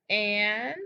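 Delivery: ordinary talk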